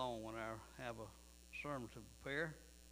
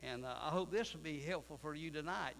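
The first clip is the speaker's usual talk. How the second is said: usual speaking voice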